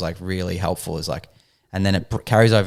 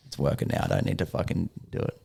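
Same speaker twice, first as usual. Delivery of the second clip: whispered thought; 3 semitones lower, 7 dB quieter than usual. Retold or imitated. Relieved inner thought.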